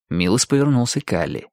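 plain reading voice

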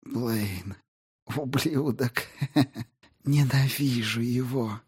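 soft, high voice with a faint bitter laugh. Acted. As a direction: curse him weakly through a chuckle. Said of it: "в нём прозвучала горечь". (тихо, с горьким смешком)

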